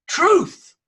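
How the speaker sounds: "Truth" high, loud and swooping down; "Truth" thrown up high with force; emphatic repeat. Shown as a demonstration. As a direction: proclaim "Truth" as a ringing single word. Repeats key word for emphasis.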